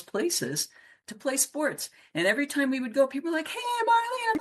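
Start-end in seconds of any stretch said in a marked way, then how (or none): 3.5-4.3 high-pitched mock greeting from a townsperson; imitated hello across the way; called-out greeting. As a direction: call it out high, as if spotting someone you know. Acting out townspeople calling greetings.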